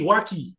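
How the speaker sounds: waki said "WAH-kee", with a hard k; misread pronunciation. Shown as a demonstration. Showing how K Y E looks to him, waki with hard k. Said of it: "I look at that, I say".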